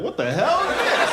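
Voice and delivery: high, loud mock shock; outraged disbelief, played up big; hammed-up outrage at a rude nurse. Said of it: "I was like".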